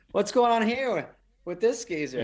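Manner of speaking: high mocking question; lightly voiced reporter, teasing; a hint of a snide crew member; nosy crew.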